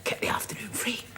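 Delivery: whispered aside during the scene; hushed aside in rehearsal. Acted. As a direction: whisper so the actor won't hear.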